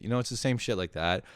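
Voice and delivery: ordinary talk, nothing marked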